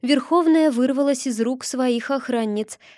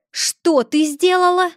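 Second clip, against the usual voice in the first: higher, louder, through gritted teeth; 7 semitones higher, 6 dB louder than usual. A narrator's reading. High witch confronts Dorki over the murder, furious through teeth. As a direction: demand it with fury. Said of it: "процедила сквозь зубы".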